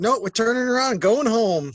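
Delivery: high, loud retold veto; firm parental refusal. Parent quoting own answer to kids, firmly refusing.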